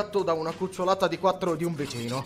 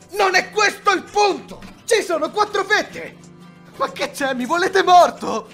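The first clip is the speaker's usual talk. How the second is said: higher, exasperated outburst; esasperato; 6 semitones higher, 4 dB louder than usual. Acted. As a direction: protest it hotly, voice jumping up. Acted quarrel over four slices. (esasperato, a voce alta)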